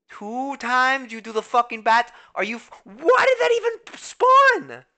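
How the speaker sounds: loud, high-pitched angry yelling at the game; angry outburst. Real, not acted. Streamer yelling at his game over a bat, furious and loud. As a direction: rage at the bat that knocked you down.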